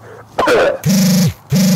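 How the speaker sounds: loud breathy mock cough; a hammed-up cough for the Lorax; acted cough. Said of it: "please pardon my cough".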